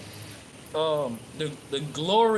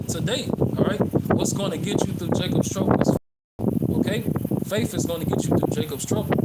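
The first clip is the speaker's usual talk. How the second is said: louder, raised preacher's call; fervent preaching; 4 semitones higher, 5 dB louder than usual. Acted. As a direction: drive the point home to the congregation. Preaching point on faith.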